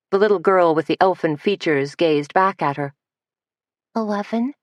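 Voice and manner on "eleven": lisp. Acted. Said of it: "she said with a lisp".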